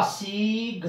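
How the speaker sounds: सी घ sounded out slowly, each piece held apart; teacher spelling out सी घ; syllable-by-syllable demo. Shown as a demonstration. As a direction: draw out सी, then sound घ separately. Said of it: "उच्चारण तो इसका यही होगा".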